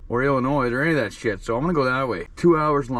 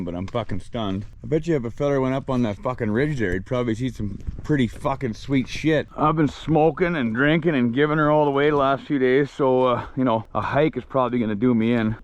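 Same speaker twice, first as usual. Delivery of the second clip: ordinary talk, nothing marked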